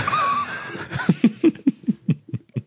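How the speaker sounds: high laugh on the in-breath; inhaled laugh. Pretend. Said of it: "redet auch immer so beim Einatmen". (Lachen beim Einatmen)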